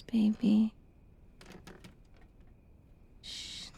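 ordinary talk, nothing marked

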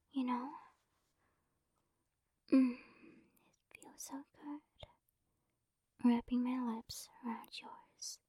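normal talking voice

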